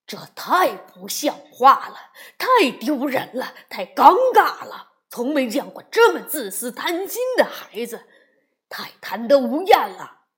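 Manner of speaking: loud erupting rant; furious outburst. Acted. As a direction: let the anger explode at them. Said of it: "熊爸爸的怒气像火山一样喷". (熊爸爸怒气爆发，大声斥责孩子们)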